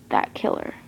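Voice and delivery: breathless whispered threat. A narrator's reading. Vowing revenge on the killer, whispered. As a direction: hiss it under the breath. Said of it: "I would find".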